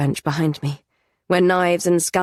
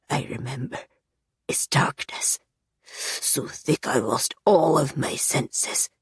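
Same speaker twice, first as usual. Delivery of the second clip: haunted whisper; 4 semitones lower, 3 dB quieter than usual. Acted. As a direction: recall the horror quietly.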